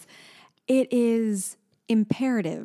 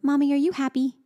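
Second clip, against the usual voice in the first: higher-pitched imitation of a toddler; toddler daughter; 7 semitones higher, 3 dB louder than usual. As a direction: ask mommy as a little child would, pitched up. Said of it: "And she'll go".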